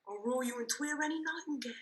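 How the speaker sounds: soft, whispered lion roar; gentle roar. Shown as a demonstration. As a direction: whisper it like a cooing dove. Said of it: "roar you as gently as any sucking dove".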